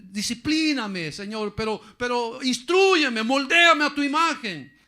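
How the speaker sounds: slow, loud, rising prayer to God; impassioned sermon cry; fervent preached plea. Acted. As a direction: beg God from the pulpit. Preacher pleading at full force.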